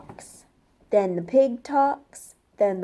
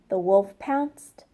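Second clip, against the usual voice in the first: plain reading voice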